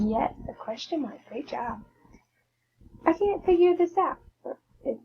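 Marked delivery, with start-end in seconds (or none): 3.1-4.2 much higher and louder exasperated cry; exclaimed example. Shown as a demonstration. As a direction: blurt it out, frustrated.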